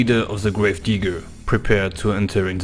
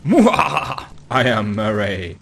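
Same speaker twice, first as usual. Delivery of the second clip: mad scientist. Acted. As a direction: cackle it out like a mad genius. Villain gloats after report.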